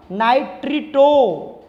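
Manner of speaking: नाइट्रिटो slowly in drawn-out syllables; pronunciation demo. Shown as a demonstration. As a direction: teach नाइट्रिटो as nai-tree-toh, long final o. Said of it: "इसको बोलेंगे हम". (नाइट्रिटो का धीमा, खींचा हुआ उच्चारण)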